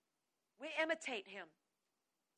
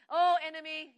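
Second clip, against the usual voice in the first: loud, taunting address; loud acted derision; God mocking the enemy; 2 semitones higher, 9 dB louder than usual. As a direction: call out the foe with mock pity. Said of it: "The Lord laughs at the enemy".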